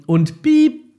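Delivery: Piep squeaked high and loud; a sharp high spoken bleep. Shown as a demonstration. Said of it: "mach immer Piep". (hohes lautes Piep statt Schimpfwort)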